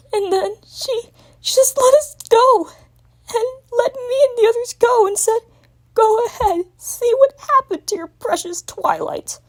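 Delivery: high, crying, distressed dragon; frantic tearful voice. A narrator's reading. Young dragon tells Twilight the ending, in distress. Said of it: "in a more distressed tone".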